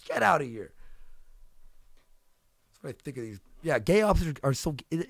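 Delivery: ordinary talk, nothing marked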